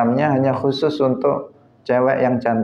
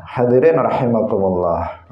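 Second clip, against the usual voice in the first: ordinary talk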